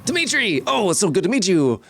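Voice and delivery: delighted, high-pitched showman welcome; excitable carnival barker. Acted. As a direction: greet them like a thrilled barker. Game master plays carnival barker.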